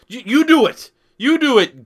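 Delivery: loud, high hype call; hyped urging. Real, not acted. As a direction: cheer him on, pumped up. Urging a viewer on chat.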